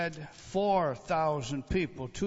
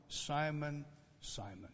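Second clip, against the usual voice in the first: tender calling; 9 dB quieter, slower than usual. Acted. Jesus tenderly addressing Simon, hushed. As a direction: call his name gently, fading.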